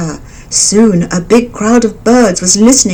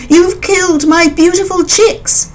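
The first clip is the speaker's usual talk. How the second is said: accusing mother bird; 11 semitones higher than usual. A narrator's reading. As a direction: scold the beast who killed your chicks.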